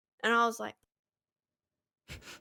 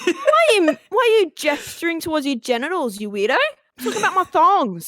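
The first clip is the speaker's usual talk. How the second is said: exasperated mock outburst, pitched up; acted indignation; 6 semitones higher than usual. Arguing over a misunderstood word, mock indignant. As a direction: challenge him in baffled disbelief.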